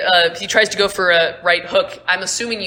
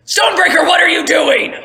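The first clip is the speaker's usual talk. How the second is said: high, loud outraged yell; Merrick shouting; 10 semitones higher, 8 dB louder than usual. Acted. Merrick yelling at Stonebreaker for the tackle, outraged. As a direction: bellow at Stonebreaker to stop. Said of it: "And Merrick goes".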